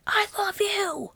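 high-pitched mock yell, no real volume. Shown as a demonstration. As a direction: yell the loving words in a very high, drawn-out voice. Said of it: "you're being yelled at".

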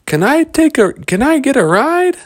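put-on very high, loud little-boy voice; eager begging. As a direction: squeal it out as an eager Lost Boy. Lost Boy begs for a ride.

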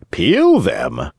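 taken-aback echo, rising question; surprised. Acted. Geppetto is asked to peel the pears, surprised question. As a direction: ask it back in mild astonishment. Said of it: "very much surprised".

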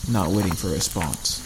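usual speaking voice

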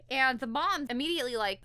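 usual speaking voice

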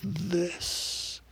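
put-on terse old cowboy, softly drawn out; one soft, weighty word from an old cowboy; rough cowboy. Cowboy holds up one finger, rough cowboy voice. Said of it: "in his rough cowboy voice".

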